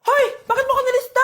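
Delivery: high, indignant shout; angry confrontation. Acted. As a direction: yell at the class monitor. Student confronts noisy-list monitor. (galit na pasigaw)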